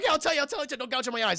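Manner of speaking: high-pitched, hammed-up begging; mock panicked plea. Tuco begs his torturer to stop, mock panic. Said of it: "And he starts screaming".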